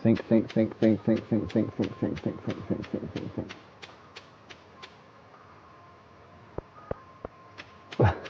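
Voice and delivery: 생각하며 혼잣말로 중얼거림, 점점 작아짐; fading from a mutter into a breathy near-whisper; muttered thinking. Real, not acted. Muttering to himself while thinking.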